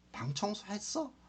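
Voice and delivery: mildly annoyed, high rising question; irritated question. Acted. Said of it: "이렇게 짜증 투르게 했다면은".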